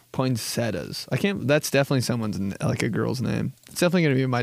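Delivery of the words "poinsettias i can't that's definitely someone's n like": ordinary talk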